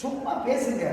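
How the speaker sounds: ordinary talk, nothing marked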